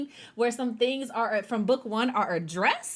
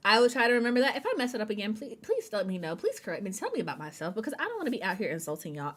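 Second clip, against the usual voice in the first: ordinary delivery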